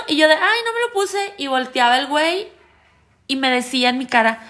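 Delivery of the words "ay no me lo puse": high, light quoted voice; acting out her own excuse; retold excuse. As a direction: pitch it up as an offhand excuse. Retelling her own excuse to fiancé.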